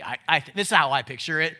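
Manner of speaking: ordinary talk, nothing marked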